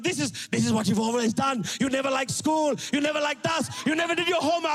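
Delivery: acted parental scolding; imitated yelling dad. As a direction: rant like a fed-up dad, rising in pitch. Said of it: "So he's yelling at me".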